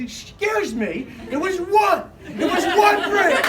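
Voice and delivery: high, loud, slow indignant protest; indignant correction. Retold or imitated. Comedian correcting the kid's claim, loud and indignant. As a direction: protest like your honour is at stake. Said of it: "So I ran in there and I said".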